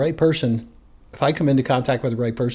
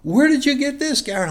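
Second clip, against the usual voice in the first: much higher, startled demand; surprised question; 13 semitones higher than usual. Retold or imitated. Friend holding up the magazine, asking Gary in high surprise. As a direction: catch him out with it.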